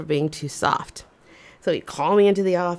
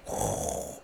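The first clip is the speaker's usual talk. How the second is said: relieved sigh; 10 dB quieter than usual. Retold or imitated. Relief after embarrassing answer.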